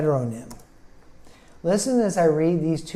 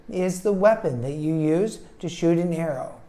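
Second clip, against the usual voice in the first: usual speaking voice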